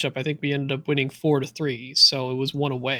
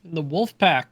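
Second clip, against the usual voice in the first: ordinary talk, nothing marked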